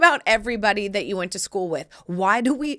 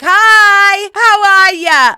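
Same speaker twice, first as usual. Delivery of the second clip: much higher, louder, slow waving hello; overexcited greeting; 12 semitones higher, 17 dB louder, much slower than usual. Retold or imitated. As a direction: greet them like a giddy superfan. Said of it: "very excited person that's like".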